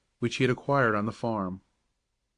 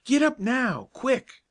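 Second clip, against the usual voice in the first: high, urgent cry; cried out; 12 semitones higher, slower than usual. Acted. Joe crying out to the balking horse: high urgent call. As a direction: urge the horse on in a panic. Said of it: "he cried".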